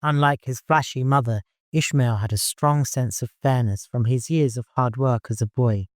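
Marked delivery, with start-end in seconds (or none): none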